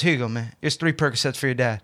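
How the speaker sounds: low, soft voice of a buddy handing something over; retold low-key offer. Friend discreetly offering pills for his dad, low and quiet. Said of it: "he goes like this".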